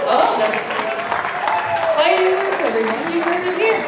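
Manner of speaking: arena host whipping up the room; hyped-up crowd call. Acted. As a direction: rile up the crowd over a challenge. Hyping a rival's challenge.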